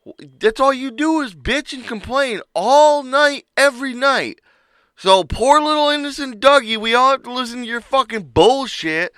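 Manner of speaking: high, loud ranting tirade; heated rant. Retold or imitated. Telling off the complaining coworker, heated rant. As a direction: unload every complaint on him. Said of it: "And I'm like".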